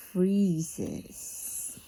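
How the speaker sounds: ordinary delivery, nothing marked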